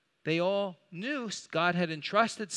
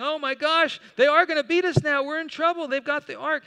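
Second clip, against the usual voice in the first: higher, louder, fast alarmed cry; panicked Philistines; 8 semitones higher, 8 dB louder than usual. Retold or imitated. Preacher voicing terrified Philistines to the congregation, panicked. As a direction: voice the enemy soldiers' panic, fast and loud.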